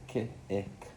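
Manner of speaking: Ki, short gap, then ick; sounding out. Shown as a demonstration. Pupil finger spelling kick, sounds in two parts. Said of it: "Make sure we separate those sounds".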